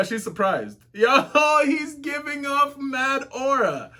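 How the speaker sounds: ordinary talk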